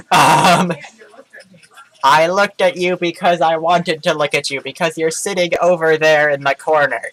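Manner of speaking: loud, fed-up yelling at an intruder; annoyed yelling. Real, not acted. Telling the sibling in the corner to go away, loud and annoyed. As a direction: shout them out of the room.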